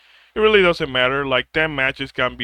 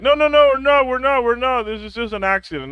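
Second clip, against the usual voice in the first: flustered denial; 9 semitones higher, faster than usual. Retold or imitated. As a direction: protest like you've been caught.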